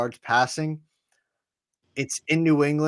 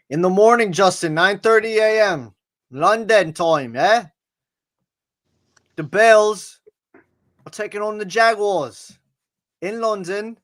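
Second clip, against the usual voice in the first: put-on London voice, slow and higher; mock British accent; 8 semitones higher, 7 dB louder, slower than usual. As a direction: play a chirpy Brit announcing the game. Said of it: "You can't do any accent with me".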